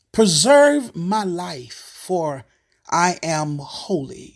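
slow, solemn reading of the psalm; scripture reading. Acted. Pastor reading the psalm to listeners, slow and solemn. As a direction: lay each phrase down slowly before the congregation.

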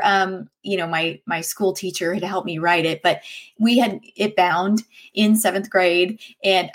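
ordinary delivery

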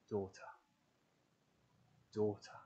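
soft, tender calling of daughter; Jesus turning to the kneeling woman, tender and soft; tender address. Acted. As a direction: say Daughter softly, like a loving father.

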